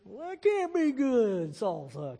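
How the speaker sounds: high, slow hillbilly incredulity; hammed-up southern drawl, scandalized by what was heard; mock-outraged cowboy twang, stretched out; hillbilly friends' disbelief. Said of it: "his friends go".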